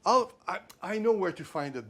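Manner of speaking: high, loud, over-eager shop clerk; eager clerk's comedy. Retold or imitated. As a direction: play the helpful clerk with a put-on eagerness. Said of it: "and says".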